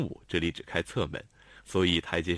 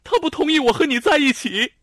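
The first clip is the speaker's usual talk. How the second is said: much higher, much louder cry; tearful shout; 10 dB louder than usual. A narrator's reading. Lanlan cries out to Xiao Tong about her father, tearful shout. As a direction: cry it out near tears. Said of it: "她红着眼叫喊".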